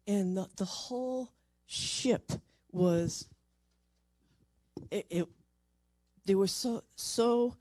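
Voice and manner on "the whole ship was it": usual speaking voice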